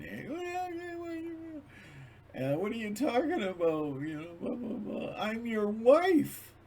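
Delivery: very high woman's voice, tearful and pleading; upset wife. Retold or imitated.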